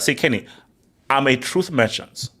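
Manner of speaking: ordinary delivery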